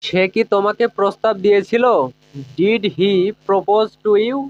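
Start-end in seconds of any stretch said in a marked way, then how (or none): 2.6-4.4 each word spoken slowly and clearly for learners; teacher modelling a question slowly; slow, careful English. Shown as a demonstration. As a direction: lay out every word so students can copy it. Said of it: "এইভাবে বলবো।".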